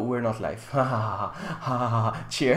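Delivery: usual speaking voice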